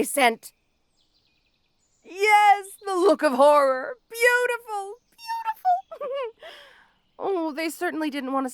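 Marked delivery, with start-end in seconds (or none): 2.0-4.9 gloating delight. Acted. Villain savoring the victim's horrified face, gloating. 5.1-6.5 ecstatic gloating. Acted. Villain rapturously gloating over the victim's horror, drawn-out high swoon.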